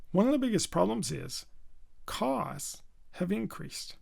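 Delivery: pause, then stretch "costs" long before "have increased"; timed key-word stress. Shown as a demonstration. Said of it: "Notice my timing".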